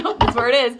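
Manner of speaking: giggling through the words; can't stop laughing. Real, not acted. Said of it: "[name], get it together".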